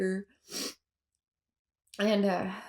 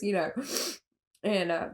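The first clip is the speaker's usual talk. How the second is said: ordinary delivery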